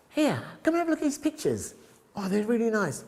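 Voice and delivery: overly sweet polite voice. Retold or imitated. Voicing herself being polite.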